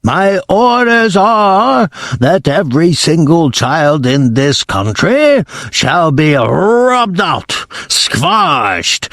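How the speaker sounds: menacing witch decree; Grand High Witch giving orders. Acted. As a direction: lay down the orders slowly like a tyrant.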